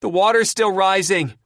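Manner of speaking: high, loud shout; alarmed shout. A narrator's reading. Chrissy warning Terry of rising water, shouting. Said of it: "Chrissy shouts".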